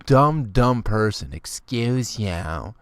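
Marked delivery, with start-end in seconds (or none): 1.3-2.7 slow, haughty rising "Excuse you"; drawn-out stuck-up put-down; snotty "excuse you". Retold or imitated. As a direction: sneer it like a prissy girl. Repeating the girl's put-down.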